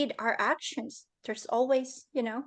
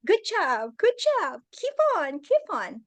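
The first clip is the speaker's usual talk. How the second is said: cheering on; 7 semitones higher than usual. Retold or imitated. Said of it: "And I was like".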